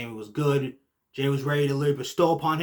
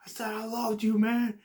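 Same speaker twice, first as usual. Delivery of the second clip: a pleading, higher voice for the betrayed brother; hurt brother; 8 semitones higher than usual. Retold or imitated. Jey pleading with his brother, hurt and higher. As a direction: play the betrayed brother begging. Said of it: "And Jey's like".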